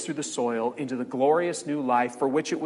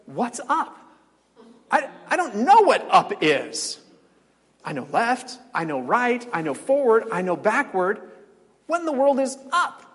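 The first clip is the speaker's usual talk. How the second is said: higher, puzzled little creature who cannot grasp up; baffled flat creature; 6 semitones higher, 4 dB louder than usual. Retold or imitated. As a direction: play him lost and confused, voice pitched up. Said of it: "the two-dimensional creature says".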